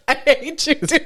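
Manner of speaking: high, playful fake outrage; daughter's advice sets off mock outrage. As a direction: squeal it like a teasing protest. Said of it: "And I was like".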